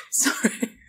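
breathy laugh into an apology. Real, not acted. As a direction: laugh at your own joke. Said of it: "I'm laughing at my own jokes".

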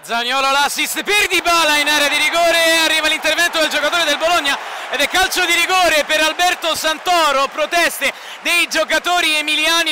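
excited play-by-play. Real, not acted. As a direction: call the foul with a jolt of excitement. Penalty awarded, live call.

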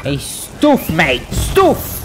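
put-on British accent, rising to a squeal; mock British accent. Player showing off a British accent, exaggerated British delivery. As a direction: play it up as a British bloke. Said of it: "My British accent out here".

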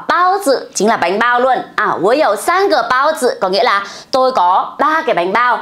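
ordinary delivery

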